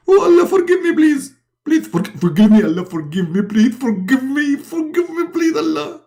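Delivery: very high begging voice; pleading Muhammad. Acted. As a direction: beg frantically for forgiveness.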